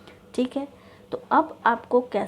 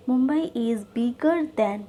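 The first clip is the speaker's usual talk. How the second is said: very slow, "most" leaned on; deliberate model sentence; slow dictation; much slower than usual. Shown as a demonstration. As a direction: dictate with "most other" marked. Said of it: "अब change हो जाएगी most other में".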